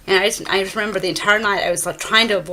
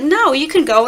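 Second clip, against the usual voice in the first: loud, high and offhand; loud dismissive brush-off; 7 semitones higher, 6 dB louder than usual. Retold or imitated. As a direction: brush her off, loudly. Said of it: "he was like".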